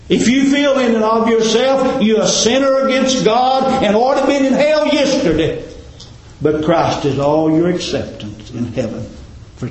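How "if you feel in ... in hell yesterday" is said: preaching climax. Acted.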